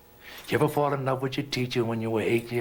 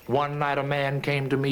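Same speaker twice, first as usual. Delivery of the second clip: plain reading voice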